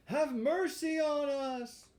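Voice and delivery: high, slow drawn-out plea; begging fox voice. Acted. Fox begging Pinocchio, high slow plea. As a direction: stretch out the plea for mercy.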